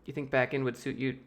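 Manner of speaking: ordinary talk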